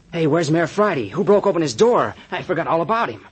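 loud, high-pitched alarm; startled demand. Acted. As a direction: call out in sudden alarm. Man suddenly remembers missing mayor.

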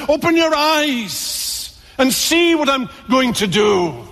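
high, slow, a lightly voiced divine summons; voice of God calling. God to his wandering children, high slow calling. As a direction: call them to open their eyes.

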